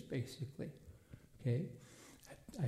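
usual speaking voice

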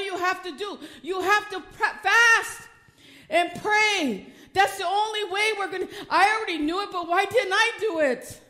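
loud, high cry of fast and pray; fervent preaching climax. Acted. Preacher crying out to the church to fast and pray. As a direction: preach it at full force.

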